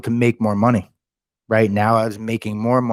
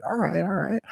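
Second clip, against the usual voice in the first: high, soft mock boss going along with it; lightly voiced amused sales manager; 10 semitones higher, 5 dB quieter than usual. Sales manager reacts to his answers.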